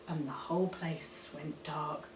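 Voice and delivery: dark sudden hush; hushed drop. A narrator's reading. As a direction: let it fall into darkness. Lights go out.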